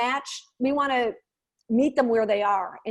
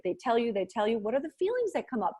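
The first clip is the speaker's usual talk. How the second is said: normal talking voice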